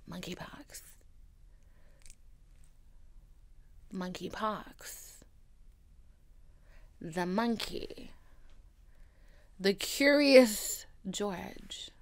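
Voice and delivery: slow, hushed, fearful murmur; hushed dread over monkeypox. Acted. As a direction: whisper it like you're haunted. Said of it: "Like, fear. Utter fear".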